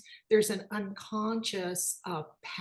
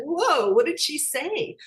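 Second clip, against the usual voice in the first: astonished; 9 semitones higher, 8 dB louder than usual. Retold or imitated. Mother startled by child's words.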